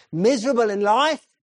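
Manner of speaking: high and loud, slowing on the promise; preacher urging young man, loud and earnest; loud earnest preaching. Acted. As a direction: press the warning, then offer salvation. Preacher warning then promising.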